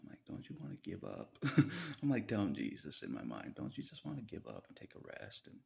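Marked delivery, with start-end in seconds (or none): 0.3-1.4 soft, unvoiced tempting whisper; tempting devil. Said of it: "I'm the devil, ... trying to tempt Jesus". 3.6-5.6 a soft breathy devil's temptation; tempting devil. Pretend. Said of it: "I'm the devil, ... trying to tempt Jesus".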